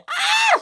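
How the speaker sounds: very high startled scream; shrieking scream. A narrator's reading. Millie bumped from behind, high scream. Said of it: "she screamed".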